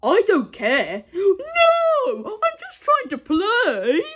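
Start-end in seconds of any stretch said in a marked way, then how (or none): 1.1-4.0 put-on whiny child voice. Child pleading with cop.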